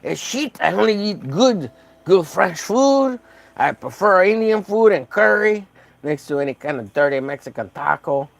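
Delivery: hammed-up fussy gourmet friend; snooty Fred impression. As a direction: play the food snob who sneers at tacos. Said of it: "He's like".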